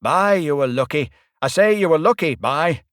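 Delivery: loud, high northern exclamation; a hearty dialect "bye, you were lucky"; exclaiming petty officer. A narrator's reading. Red-faced petty officer exclaiming to the narrator, loud dialect. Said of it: "was exclaiming".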